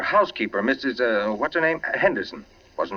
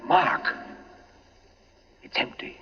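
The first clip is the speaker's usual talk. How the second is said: hushed whisper in the tomb; 8 semitones higher, slower than usual. Acted. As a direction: breathe it out, barely voiced. Whispering in the dark crypt.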